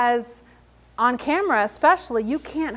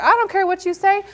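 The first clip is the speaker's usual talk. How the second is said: defiant retort; 8 semitones higher, 6 dB louder than usual. Retold or imitated. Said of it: "I'm gonna be like".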